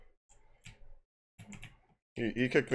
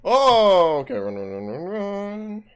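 being chased; 9 semitones higher, 8 dB louder, slower than usual. Real, not acted. Fleeing enemy in game.

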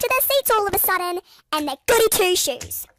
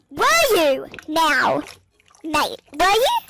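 sarcastic; 3 dB louder, slower than usual. Retold or imitated. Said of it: "they go".

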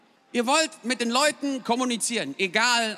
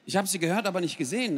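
usual speaking voice

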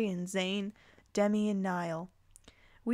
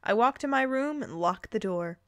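normal talking voice

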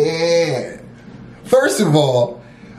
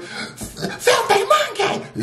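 very high, slow, strained villain shriek; desperate Frieza shriek; much slower than usual. Retold or imitated. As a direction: scream like a cornered tyrant losing it. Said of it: "But his anger, when he gets desperate".

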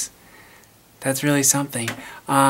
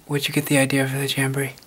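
usual speaking voice

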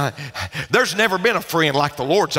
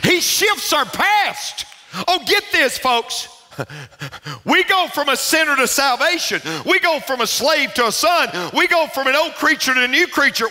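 high, ringing preacher delivery; impassioned preaching; 10 semitones higher than usual. Real, not acted. Preacher urging a congregation toward new life, impassioned. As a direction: preach it out at full pitch.

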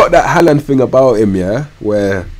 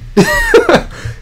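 laugh. Real, not acted. Amused at the angry fans.

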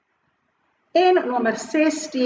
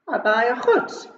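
ordinary talk, nothing marked

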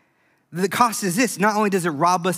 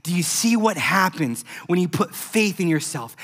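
ordinary talk

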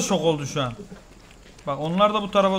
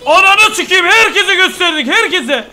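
loud, high laughing outburst; cracking up at the chaos; excited laughing; 10 semitones higher, 8 dB louder than usual. Real, not acted. Game chaos unfolding.

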